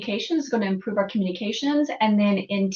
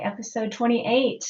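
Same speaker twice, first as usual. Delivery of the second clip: normal talking voice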